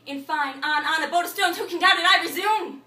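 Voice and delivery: high, loud, insistent ranting; frenzied declamation, climbing; building outburst. Acted. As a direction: push harder with every phrase. Acted rant builds.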